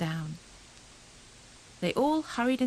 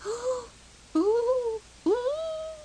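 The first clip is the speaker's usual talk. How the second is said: very high frightened squeaks; squeaking; 4 dB louder, much slower than usual. A narrator's reading. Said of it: "squeaked Squirrel".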